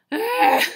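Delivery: high, loud gasp then a sharp "Ah"; collapsing into bed with a gasp; pained gasp and groan. Retold or imitated. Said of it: "I was like".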